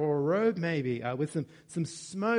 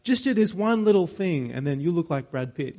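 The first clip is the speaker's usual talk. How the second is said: a lightly voiced internet ad pitch, a bit louder; mock the banal online ad, lightly; hint of a cheesy advert voice; 6 dB louder than usual. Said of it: "like those banal, uh, ads on the internet".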